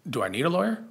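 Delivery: lawyer rising clearly, a real question. Shown as a demonstration. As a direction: ask it, lawyer lifting at the end. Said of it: "The inflection is different. It's a question".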